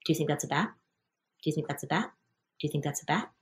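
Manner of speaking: soft, low, repeated whisper-like asking; wife asking husband in the dark, soft; quiet nagging question. Retold or imitated. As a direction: nag the husband softly in bed. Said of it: "I could say".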